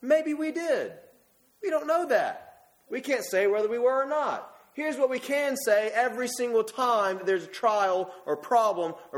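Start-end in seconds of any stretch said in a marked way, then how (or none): 0.0-7.2 rising fervor. Real, not acted. Preacher rising in pitch.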